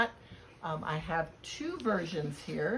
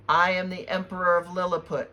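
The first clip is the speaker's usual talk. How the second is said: louder, commanding emperor; a lightly voiced commanding emperor; 9 dB louder than usual. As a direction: announce yourself like a tiny ruler. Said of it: "said a small but commanding voice".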